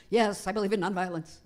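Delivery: loud, hasty-to-comply mock yes; imitating someone pushed into saying yes; pressured agreement. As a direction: agree loudly as if given no choice. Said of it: "felt like you had no choice but to say yes".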